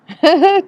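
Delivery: high, loud giggling burst; real laugh at the technical muddle. Real, not acted. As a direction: crack up briefly. Laughs at technology going wrong.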